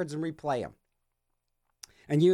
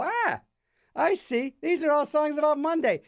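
high mock-impressed average listener; average Joe impression; 8 semitones higher than usual. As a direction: play a simple, satisfied listener, pitched up. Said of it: "the average Joe can listen and go".

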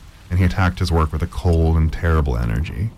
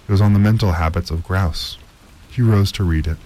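ordinary delivery, nothing marked

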